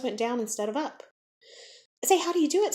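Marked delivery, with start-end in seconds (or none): 2.0-2.7 put-on much higher, louder eager boy; eager question. A narrator's reading. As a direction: ask keenly, rubbing sore knees. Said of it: "said John".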